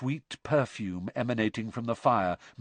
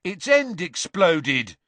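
angry; 8 semitones higher, 7 dB louder, slower than usual. Acted. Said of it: "said Dean angrily".

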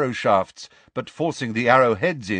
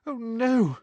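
put-on frightened girl; 11 semitones higher than usual. Hermione quaking.

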